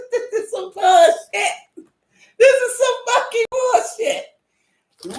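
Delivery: high, slow, laughing; laughing disbelief. Retold or imitated. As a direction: cackle at how bad it is.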